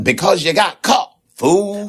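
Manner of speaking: Patty voice. Acted. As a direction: scold him like a sassy invisible sidekick. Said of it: "Hush, Patty".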